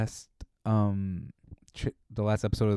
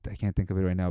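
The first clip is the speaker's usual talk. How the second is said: ordinary talk, nothing marked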